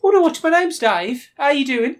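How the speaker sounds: high, loud comic Brummie; Brummie accent. Acted. Game master plays Dave on request, high Brummie accent. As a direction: introduce yourself in a hammed-up high Brummie voice. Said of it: "I want a proper Brummie".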